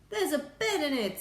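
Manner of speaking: high, loud astronaut voice; excited report. A narrator's reading. Astronaut telling the President his discovery, excited. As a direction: announce it high and amazed.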